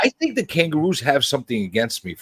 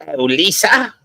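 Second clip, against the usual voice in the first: British accent imitation. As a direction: greet her in a put-on British accent. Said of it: "Isn't that how he would say it".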